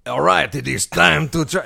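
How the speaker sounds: mock French pirate voice; hammed-up French accent. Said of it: "jump into my Jean Parmean".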